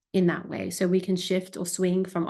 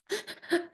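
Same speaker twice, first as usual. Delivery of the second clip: a quick, high, breathy gasp for air; sharp gasp; 10 semitones higher, 7 dB quieter than usual. Shown as a demonstration. Therapist demoing hyperventilation, one sharp gasp. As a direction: gasp in mid-panic. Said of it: "I'm hyperventilating".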